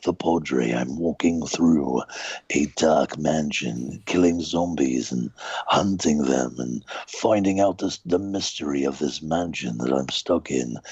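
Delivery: slow and low, a brooding priest hunting zombies; Padre character voice. Shown as a demonstration. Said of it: "combine Jeremy Irons with that voice".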